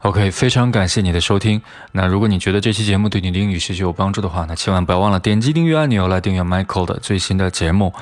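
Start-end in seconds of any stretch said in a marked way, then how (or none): 1.9-7.9 fast, run-on outro; hurried sign-off. Real, not acted. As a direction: rush through the closing announcements. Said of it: "说话这么快吗". (结尾语速很快)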